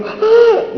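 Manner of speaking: high, loud mock scream; hammed-up shriek of panic; hysterical scream. Flight attendant as the autopilot breaks, hysterical scream. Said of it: "la azafata entró en histeria".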